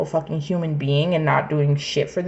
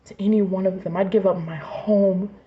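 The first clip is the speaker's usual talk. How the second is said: ordinary talk, nothing marked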